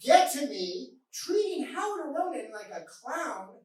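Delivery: recited like a grand poem, laughing through it; poem recital. Acted. Comic flight attendant reciting his poem, hammed-up. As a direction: declaim it with mock pride.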